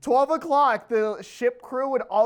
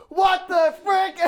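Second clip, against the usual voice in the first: slow yell, pitched up and louder; mock yell; 7 semitones higher, 4 dB louder, slower than usual. Yelling heard from the bathroom, retold as a mock shout. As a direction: shout it like someone furious.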